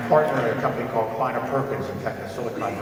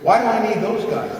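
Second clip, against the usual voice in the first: indignant retort; 11 semitones higher, 6 dB louder than usual. Retold or imitated. Retold reply to a question.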